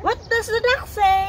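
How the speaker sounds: high, quick playful question; playful prompting. Real, not acted. Parent prompts child for the duck sound, high and quick. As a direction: ask it high and fast to coax an answer.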